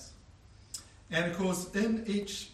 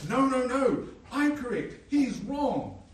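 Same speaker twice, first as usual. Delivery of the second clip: loud, higher mock argument; mock lawyer shouting; 7 semitones higher, 6 dB louder, slower than usual. Lawyers arguing, escalating.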